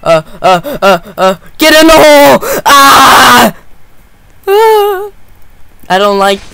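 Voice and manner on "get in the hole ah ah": high, loud panicked yell; panicked shout. Real, not acted. Gamer yells at the hole while the killer closes in, high panicked shout. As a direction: scream at the hole to open.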